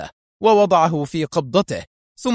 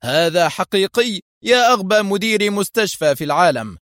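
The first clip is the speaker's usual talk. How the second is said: higher, mildly mocking taunt; 6 semitones higher, 4 dB louder than usual. A narrator's reading. Fake inspector taunts the hospital director, mocking. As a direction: taunt him with raised pitch. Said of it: "تبدل صوت المفتش وهو يقول ساخرا".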